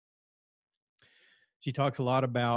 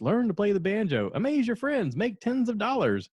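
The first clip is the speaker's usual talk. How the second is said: high, hammed-up pitchman voice; mock announcer hawking banjo lessons; old newspaper ad; 10 semitones higher, 5 dB louder than usual. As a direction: sell it like a cheesy old classified ad. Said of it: "It advertised".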